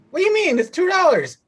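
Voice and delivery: very high, indignant haggler impression; haggling Haitian lady. Street haggler disputing a two-dollar price, high mock outrage. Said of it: "like a Haitian lady".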